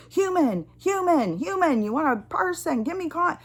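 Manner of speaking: high, insistent exasperated calls; exasperated demanding. Retold or imitated. Caller begging an automated line for a person, exasperated. Shouting at automated phone system.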